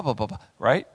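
normal talking voice

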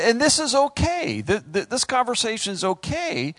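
ordinary talk

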